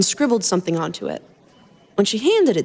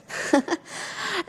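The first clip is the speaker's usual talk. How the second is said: giggle; 8 semitones higher, 8 dB quieter than usual. A narrator's reading. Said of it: "She giggled".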